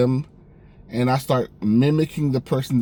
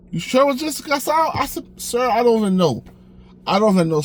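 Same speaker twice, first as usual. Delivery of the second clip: high pleading voice; 9 semitones higher, faster than usual. Retold or imitated. Manager doubts, he pleads.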